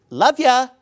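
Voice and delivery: mock casual. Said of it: "more meaningful than just".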